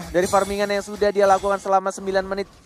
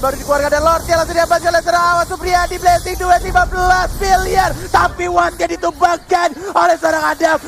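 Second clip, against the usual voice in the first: high, loud hype call; excited; 10 semitones higher, 5 dB louder than usual. Real, not acted. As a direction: shout the Lord steal. Lord steal called out.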